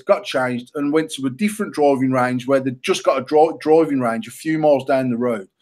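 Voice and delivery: ordinary talk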